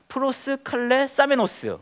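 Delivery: "프로스 칼레 사메노스"를 천천히 한 음절씩 높게, "칼레"에 힘주어; 헬라어 단어를 또박또박 천천히 읽어 주기. Shown as a demonstration. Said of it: "이게 부른다예요". ("칼레"를 강조하며 느리게 읽는 헬라어 발음)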